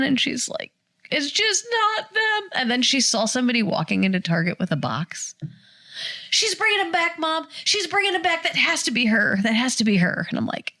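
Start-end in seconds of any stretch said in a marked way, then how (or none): 1.1-2.5 high, slow, hopeless daughter voice; grieving daughter. Retold or imitated. 6.3-10.3 lightly voiced daughter, high and urgent; higher, like a hopeful child pointing; insistent daughter. Daughter insisting, still upset.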